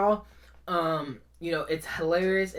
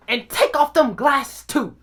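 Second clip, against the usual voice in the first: loud, high, slow command; angry mom order; 7 semitones higher, 7 dB louder, slower than usual. Retold or imitated. Mom to son she is punishing, loud slow order. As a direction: imitate a mother laying down the law.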